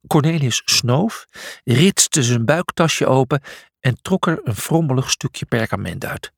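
ordinary delivery, nothing marked

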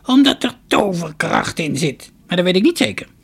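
put-on high, loud, creaky old woman's voice; shrill-pitched storybook crone, full voice. A narrator's reading. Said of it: "zei de oude vrouw".

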